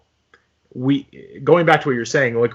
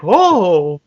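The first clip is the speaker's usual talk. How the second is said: put-on raised old-movie heroine exclamation; high, old-fashioned "oh"; Dorothy; 5 semitones higher than usual. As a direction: lightly imitate a transatlantic film heroine. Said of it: "how Dorothy from The Wizard of Oz".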